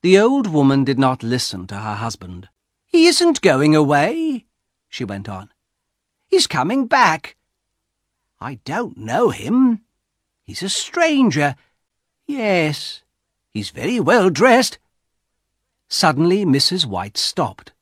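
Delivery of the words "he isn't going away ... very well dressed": put-on higher, curious old wife; lightly voiced wife at the window; wife's voice. A narrator's reading. Wife voiced in read story.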